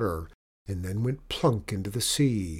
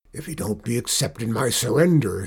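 loud, crusty pirate argument; pirate captain; 5 dB louder than usual. A narrator's reading. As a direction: argue it out as an old sea dog. Pirate argues over surrender.

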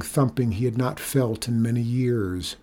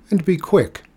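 higher, impatient order; sharp demand in a raised pitch; harsh; 7 semitones higher, 4 dB louder than usual. A narrator's reading. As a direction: hurry him along harshly. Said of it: "said Rico harshly".

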